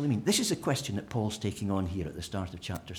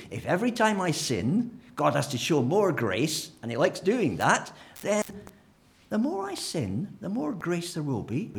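higher, louder, mock-delighted reasoning; mock enthusiasm; 6 semitones higher, 4 dB louder than usual. Preacher parodying sin-for-grace thinking, mock glee. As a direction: sell the absurd logic gleefully. Mocking the sin-more logic.

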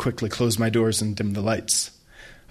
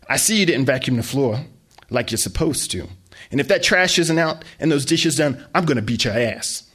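loud, threatening scold; mother threatening her son; scolding mom; 4 semitones higher, 4 dB louder than usual. Acted. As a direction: lay into the kid.

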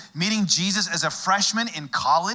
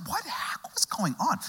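whispered; 8 semitones higher, 6 dB quieter than usual. Retold or imitated. Wife's puzzled question, whispered.

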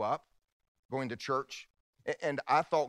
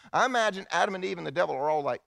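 normal talking voice

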